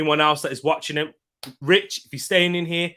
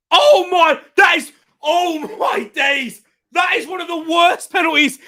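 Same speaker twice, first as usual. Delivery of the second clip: very high, loud disbelief; appalled shout; 14 semitones higher, 8 dB louder than usual. Real, not acted. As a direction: cry out aghast at a terrible miss. Appalled by a missed penalty.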